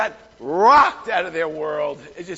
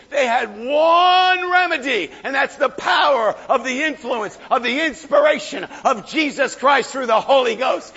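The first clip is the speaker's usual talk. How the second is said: fervent preaching; 4 semitones higher, 7 dB louder than usual. Acted. Preacher proclaiming the Holy Ghost's power to the church, fervent preaching. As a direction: swell into a fervent cry. Preaching about one remedy.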